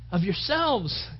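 usual speaking voice

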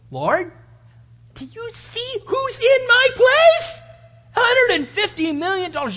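very high, loud, stretched-out plea; mock pleading prayer. Man begs the Lord over millions, mock pleading. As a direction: whine up to heaven, hammed-up.

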